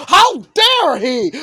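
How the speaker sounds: an angry sibling furious at the kidnapper; angry outburst. Acted. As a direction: bark it like an outraged brother.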